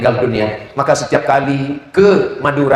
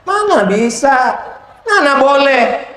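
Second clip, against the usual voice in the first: slow, high incredulous; 12 semitones higher, 3 dB louder, slower than usual. Real, not acted. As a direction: stretch out the disbelief. Preacher objects.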